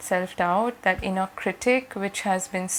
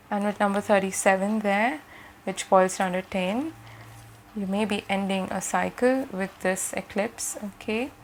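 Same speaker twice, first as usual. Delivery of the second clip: ordinary delivery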